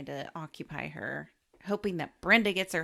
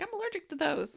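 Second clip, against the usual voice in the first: mock complaint; 12 semitones higher, faster than usual. Acted joke quote, raised voice.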